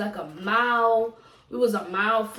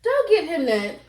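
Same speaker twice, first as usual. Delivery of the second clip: sharp telling-off; 7 semitones higher, 4 dB louder than usual. Real, not acted. Scolding about feeding the dog.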